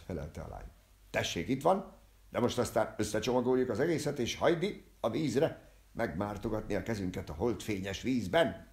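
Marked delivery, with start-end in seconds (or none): 1.1-8.7 exclaiming. Acted. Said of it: "kiáltott fel a direktor úr".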